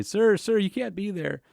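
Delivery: high-pitched, urgent call to a stranger; calling out a warning. Retold or imitated. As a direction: act out flagging down a rule-breaker. Acting out someone calling him back.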